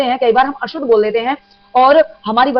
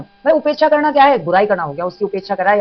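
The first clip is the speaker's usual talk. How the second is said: ordinary delivery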